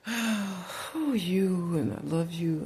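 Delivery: high, sighing mock swoon; mock lovey-dovey. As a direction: coo it like a besotted lover, hammed up. Acting out lovers in bed.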